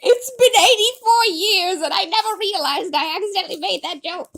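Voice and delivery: high squealing disbelief. Real, not acted. Author realizes an accidental joke, squealing. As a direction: squeal it through the hilarity.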